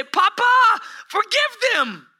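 high, sustained cry of "Papa"; impassioned plea. Acted. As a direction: cry out to a father, pleading from the cross. Quotes Jesus on the cross.

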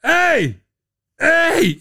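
high mock yells of Hey; imitating a yell. Host imitating a player yelling every possession, mock shouts. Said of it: "who screams out".

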